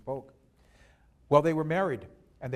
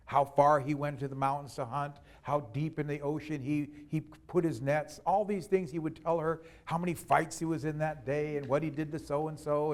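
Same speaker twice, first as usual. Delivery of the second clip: usual speaking voice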